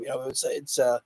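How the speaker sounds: tired, strained voice; losing voice. Real, not acted. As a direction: voice nearly gone, quiet. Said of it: "My voice is kinda killing me".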